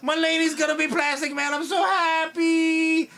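overjoyed fanboy squeal, played big; shouting with mock delight at casting rumor; hammed-up joy. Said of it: "I was like".

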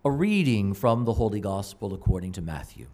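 measured solemn scripture reading; Gospel reading. Acted. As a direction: read it like a priest at Mass. Liturgical Gospel reading at Mass.

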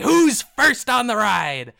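loud, high, drawn-out carnival call; showy goblin ringmaster shout; goblin showman. Acted. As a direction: holler for the first rider. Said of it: "He's like".

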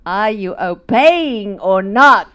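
slow, pressing question, loud; pointed challenge. Retold or imitated. Husband challenging her to obey, slow loud challenge. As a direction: lay it down word by word as a challenge. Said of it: "He says".

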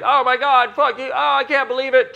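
high, loud mock freakout; hammed-up dramatic outrage; trauma and drama outburst. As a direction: throw a dramatic fit. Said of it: "one of them will be like".

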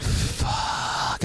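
long, whispered curse; hushed, sinking realization; whispered dread. Retold or imitated. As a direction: let it escape as a drawn-out whisper. Silent dread at seeing Tyler's face.

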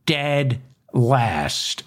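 "Dead" leaned on, then "last" falls away; stress on dead. Shown as a demonstration. Host stresses the ranking to mock Oklahoma's health care, emphasis on dead. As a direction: hit "Dead" hard before "last.". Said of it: "Emphasis on the word dead".

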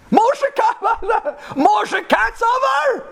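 very high, laughing exclamation; hammed-up high shout through laughter; astonished shriek. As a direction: shriek the name in disbelief. Mimics matchmakers' astonishment.

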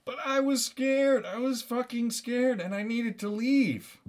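high-pitched panicky excuse; hammed-up frightened whine; scared confession. Hammed-up confession of fear in story.

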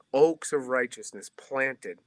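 usual speaking voice

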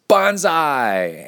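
high, loud battle cry; mock battle cry. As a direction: shout it like a samurai charging. Reading a samurai battle cry.

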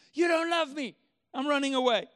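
acted-out petulant outburst at God; mock tantrum. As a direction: shout it like a sulking runaway kid. Believer sulks like the runaway boy.

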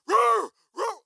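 loud, high mock bark; imitated dog bark. Preacher imitating the dog behind him, loud high bark. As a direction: bark like a big chasing dog.

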